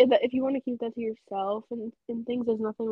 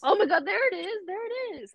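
teasing gotcha; 9 semitones higher than usual. Real, not acted. Teasing friend's gotcha remark.

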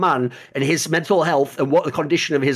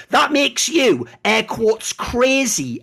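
ordinary talk

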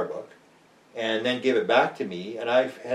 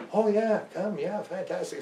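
higher, eager welcome; delighted widow; 10 semitones higher, 5 dB quieter, slower than usual. Retold or imitated. Widow welcoming the young interviewer, delighted and higher. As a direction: gush with pleasure at the request.

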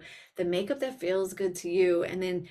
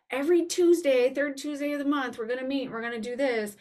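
high, emphatic mock schedule voice; mock rigid planner; 7 semitones higher, 3 dB louder than usual. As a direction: rattle it off like a strict rulebook. Mocks a rigid fixed schedule.

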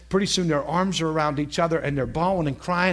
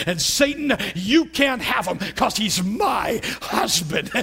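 defiant shout; 4 semitones higher, slower than usual. Retold or imitated. Wife defying Satan over her husband, slow shout. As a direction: stand your ground and shout down the devil.

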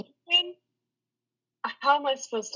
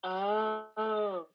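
ordinary talk, nothing marked

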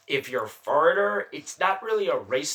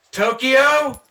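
high, loud questioning shout; acted questioning call; 10 semitones higher, 10 dB louder than usual. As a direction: call it out like a lost tourist. Acts out asking conductor for Tokyo.

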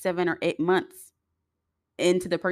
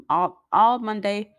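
ordinary talk

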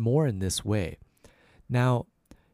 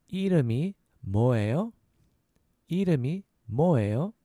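이름이 뭐예요 in two quicker pieces, rising; a little faster; 2 semitones higher, much faster than usual. Shown as a demonstration. Teacher repeating "what's your name", a little faster. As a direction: join up 이름이 뭐예요 as a question. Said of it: "So a little faster".

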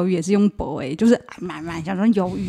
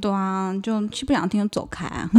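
ordinary talk

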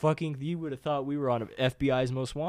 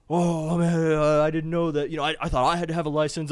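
higher, louder stammered excuses; panicky Mike; 5 semitones higher, 8 dB louder than usual. Retold or imitated. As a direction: babble excuses in a raised voice. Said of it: "And he's like".

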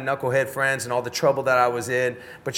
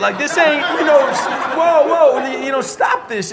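high, loud, laughing plea to stop; laughing protest; 10 semitones higher, 7 dB louder than usual. Retold or imitated. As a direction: wave them off, laughing and loud. Begging class to stop crying.

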